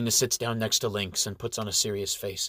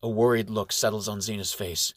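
usual speaking voice

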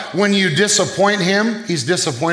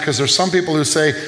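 normal talking voice

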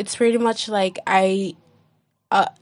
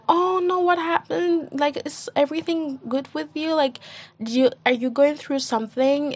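mock worried friend; 7 semitones higher than usual. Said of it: "everyone is like".

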